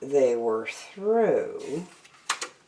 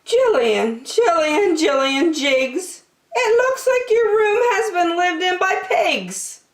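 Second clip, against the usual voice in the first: higher, louder scolding call; mother scolding her messy daughter, pitched up; exasperated mother; 4 dB louder than usual. Acted. Mother scolds again after fainting.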